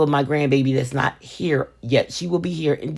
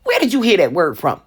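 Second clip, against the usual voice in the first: stern mom; 4 semitones higher, 4 dB louder than usual. Retold or imitated. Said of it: "I'm like".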